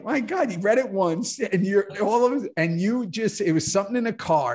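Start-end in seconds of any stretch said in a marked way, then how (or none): 0.0-2.5 mock amazement. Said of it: "and I was like".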